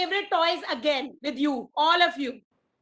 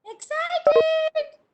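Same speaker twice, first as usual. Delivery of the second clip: much higher, louder excited call; 9 semitones higher, 4 dB louder than usual. Shown as a demonstration. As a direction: cheer it out. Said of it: "with an excited face".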